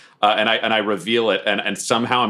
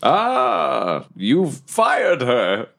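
higher, swooping theatrical voice; grandiose masked suitor; 4 semitones higher than usual. Acted. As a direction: draw words out with big pitch swoops. Plays Deathwolf on a date.

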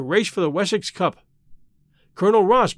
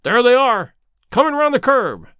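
high, louder racegoer's shout; sudden excited cry; 5 semitones higher, 4 dB louder than usual. A narrator's reading. Friend watching the race through field glass, excited cry. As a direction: call out as the horses appear. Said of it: "he cried suddenly".

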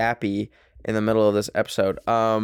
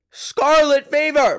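high, loud, slow squeal of disbelief; mock shocked squeal; 6 dB louder, slower than usual. Real, not acted. Podcaster gossiping about her friend, high mock squeal. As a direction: stretch it out in a shrill mock gasp.

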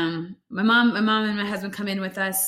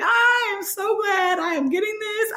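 much higher, relieved near-shout; relieved gush; 12 semitones higher than usual. Retold or imitated. To the nurses, shouting relief over the epidural. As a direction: gush with relief as the pain hits. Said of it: "I was, like, breathe".

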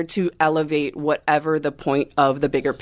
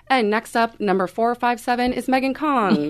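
higher-pitched contest announcer; sing-out announcer intro, pitched up; stage competition announcer; 8 semitones higher than usual. Acted. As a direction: call the next dancer up on stage. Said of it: "competing on stage, it's like".

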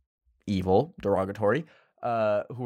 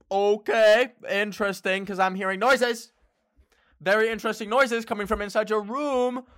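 sarcastic; 10 semitones higher, 3 dB louder than usual. Retold or imitated. Hearing noises, confronting friend.

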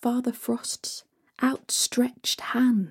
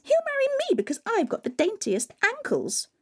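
much higher, airy dreamy voice; giddy stepsister; 14 semitones higher, faster than usual. Acted. A stepsister dreams of husbands, high and giddy. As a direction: squeal it like a silly girl.

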